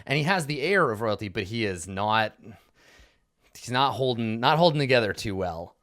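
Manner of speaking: ordinary talk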